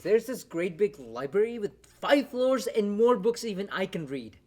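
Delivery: loud, eager rush of enthusiasm; excited gushing. Acted. Brother telling sister about the college library, excited gushing. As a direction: gush with rising pitch and volume.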